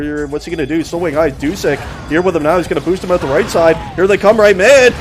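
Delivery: ordinary talk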